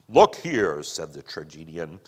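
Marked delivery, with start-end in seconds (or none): 0.1-0.8 firm, domineering man's voice; bully her into sense; manly bullying tone. Acted. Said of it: "He was using his manly bullying tone".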